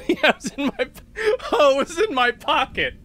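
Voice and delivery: high, laughing through the words. Real, not acted. Son laughing at mom finding the lighter in her pocket, laughing. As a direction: laugh while telling the punchline.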